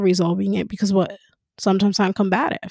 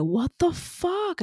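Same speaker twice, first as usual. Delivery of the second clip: frustrated; 10 semitones higher, 6 dB quieter, much slower than usual. Retold or imitated. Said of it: "and I was like".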